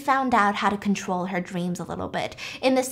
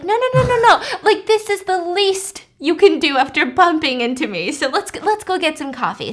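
much higher, louder, overly sweet woman; sugary wife who won't take no; high sweet insistence; 6 semitones higher, 3 dB louder than usual. Retold or imitated. Adele insists on coffee.

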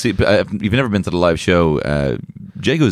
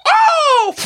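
excited yelp; 4 dB louder than usual. Real, not acted. Sudden excited outburst.

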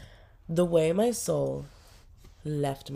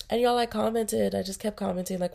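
ordinary talk, nothing marked

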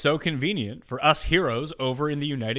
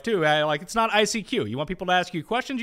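usual speaking voice

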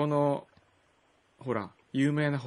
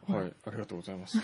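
ordinary talk, nothing marked

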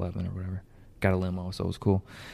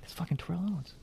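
hushed, amazed stage whisper; retold awestruck whisper; excited whisper; 8 semitones higher, 13 dB quieter, faster than usual. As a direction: whisper it so the celebrity won't hear. Excited aside to his son.

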